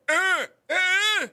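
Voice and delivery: high, loud wordless cries; imitated deaf man. Deaf Bear telling Barbara what he heard, imitated cries. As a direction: mimic insistent, drawn-out grunts. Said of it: "this guy is deaf".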